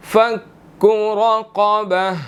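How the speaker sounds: رَقَبَةٍ with a thick ra and heavy qaf, slowly. Shown as a demonstration. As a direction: draw out رَقَبَةٍ with both heavy letters. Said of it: "را اور قاف اس کے اندر دونوں موٹے ہیں".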